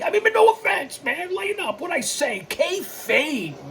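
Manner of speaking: squeaky-high, hurried backpedal after insults; put-on voice leaping far above normal; mock high-pitched protest. Host jokingly telling insulted viewers to lighten up, high mock voice.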